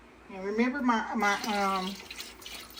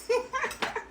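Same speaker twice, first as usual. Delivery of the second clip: high-pitched burst of laughter; quick high giggle between two words; 14 semitones higher than usual. Real, not acted. Host amused at her own "try it", high laugh.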